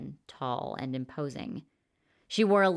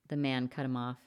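usual speaking voice

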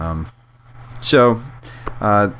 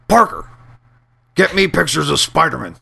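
barking newspaper editor; 6 semitones higher than usual. Pretend. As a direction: bark the order like a gruff boss. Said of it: "I don't know why I started doing that".

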